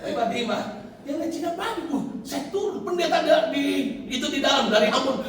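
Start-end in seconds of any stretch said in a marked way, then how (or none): none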